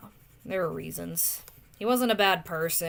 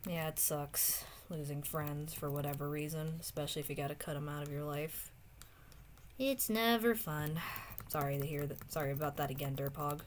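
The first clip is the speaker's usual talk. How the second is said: softer, subdued condolence; quiet sympathy; 3 semitones lower, 7 dB quieter than usual. Real, not acted. Streamer consoling a friend over lost friendships, quiet sympathy.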